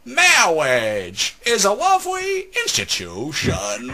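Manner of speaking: high, loud, very slow mock clergyman; hammed-up pompous wedding officiant; Princess Bride priest. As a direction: intone grandly, stretching every word. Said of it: "first words out of that guy's mouth".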